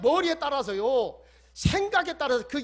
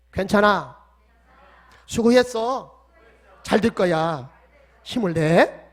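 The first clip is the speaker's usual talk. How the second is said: loud declaration; 2 semitones lower, 8 dB louder, slower than usual. Shown as a demonstration. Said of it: "따라서 합시다".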